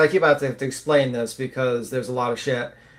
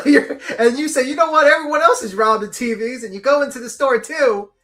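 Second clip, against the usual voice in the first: high, loud, laughing incredulity; animated mockery; 8 semitones higher, 5 dB louder than usual. Real, not acted. Host mocking the everyone-does-it defense with a robbery comparison, amused and worked up. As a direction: ridicule the excuse, voice raised and breaking into laughs.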